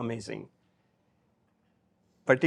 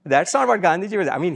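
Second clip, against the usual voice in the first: normal talking voice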